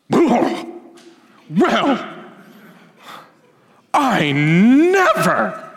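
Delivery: offended huff. Pretend. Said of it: "how would you respond".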